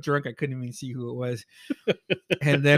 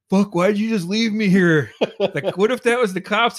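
higher, louder retold protest; mock indignant complaint; 7 semitones higher, 5 dB louder than usual. Drunk friend complaining he was left behind, mock-indignant outburst. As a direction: pitch it up, protesting at being abandoned.

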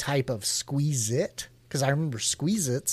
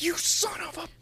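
mock berating; 5 dB quieter than usual. Customer berating a costumed Ronald McDonald, mock berating. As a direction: tear into him in a strained, squeaky voice. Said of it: "I just start tearing into him".

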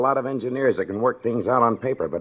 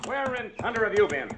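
high, sharp demand from a furious boss; boiling angry; 9 semitones higher, 6 dB quieter than usual. Acted. Foreman to his overdue engineer, boiling angry. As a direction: chew him out for being two days late. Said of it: "I could see he was boiling".

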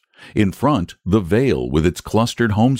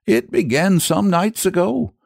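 high-pitched rustic storyteller; uneasy shepherd starting his tale; troubled shepherd; 12 semitones higher than usual. Acted. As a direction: voice a worried country shepherd.

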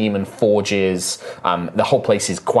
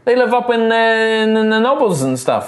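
high, incredulous halfling; halfling guide; 12 semitones higher, 3 dB louder than usual. Acted. Halfling says family heads aren't here, high incredulous voice. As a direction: scoff it in a high, halting voice.

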